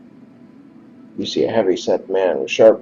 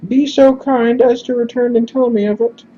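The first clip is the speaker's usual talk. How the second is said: grateful, formal falsetto; high-pitched character voice. Acted. Messenger thanks stranger and promises reward, high-pitched voice. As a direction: thank them politely, way up high. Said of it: "we're just gonna do high-pitched instead".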